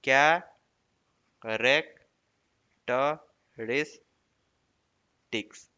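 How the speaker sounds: "कैरेक्टरिस्टिक्स" very slowly, pausing after each part; syllable by syllable. Shown as a demonstration. Teacher setting a syllable puzzle, "कैरेक्टरिस्टिक्स" slowly split. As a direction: break "कैरेक्टरिस्टिक्स" into separate drawn-out pieces. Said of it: "जिसमें कितना syllable हो सकता है".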